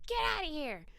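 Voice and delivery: high, exasperated cartoon dismissal; Porky Pig. Cartoon pig shooing a clingy dog, mock exasperated. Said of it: "and Porky's like".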